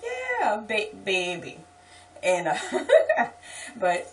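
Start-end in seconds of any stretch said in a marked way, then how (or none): 0.0-1.6 high leaping "Yeah", settling down; acted insistence starting way up high; emphatic reply. Speaker answering her husband's doubt, emphatic high reply. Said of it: "I'm like".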